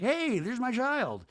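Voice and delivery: high, slow sing-song proud parent; cheery parent. Retold or imitated. Parent proudly showing off kid.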